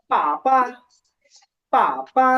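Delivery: first "পা" falls, second "পা" light; falling tone then neutral. Shown as a demonstration. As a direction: let the first "পা" drop, then a toneless "পা". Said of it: "falling tone আছে বা four tone".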